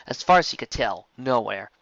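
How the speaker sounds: ordinary delivery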